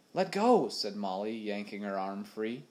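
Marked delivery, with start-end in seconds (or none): 0.1-0.7 high, loud demand; sharp protest. A narrator's reading. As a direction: snap it out as she pulls free. Molly yanks her arm free.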